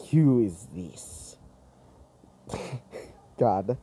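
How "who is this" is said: low, slow mock Slavic accent; attempted Slavic accent. Storyteller trying out a Slavic robot voice, attempted accent. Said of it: "About Slavic".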